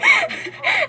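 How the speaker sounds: very high-pitched laugh; helpless high giggle; real giggle. Real, not acted. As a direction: crack up in a high squeal. Cracks up over her fears.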